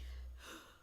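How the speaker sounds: sharp soft gasp; theatrical gasp; mock shocked gasp. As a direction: gasp in pretend horror. Shocked by topic three.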